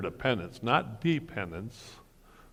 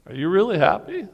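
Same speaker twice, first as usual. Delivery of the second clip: high, louder, teasing doubt; pressing, doubtful; 10 semitones higher, 9 dB louder, faster than usual. Retold or imitated. To a scowling teenager, high teasing disbelief. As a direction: needle him, you don't believe it.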